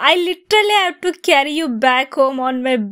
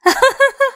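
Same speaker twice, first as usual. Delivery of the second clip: embarrassed giggle; 13 semitones higher, 5 dB louder than usual. Acted. Embarrassed laugh.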